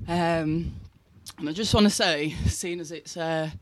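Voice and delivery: ordinary delivery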